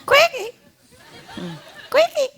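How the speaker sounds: high sing-song call to a pet; high ringing call; calling the bird. Real, not acted. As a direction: call her home from across the yard.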